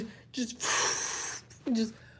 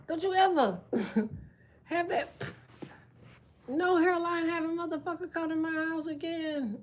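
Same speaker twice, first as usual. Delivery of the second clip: mock scolding; 6 semitones higher than usual. Aunt scolding the nephew who called his dad, mock anger. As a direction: dress the kid down like an angry aunt.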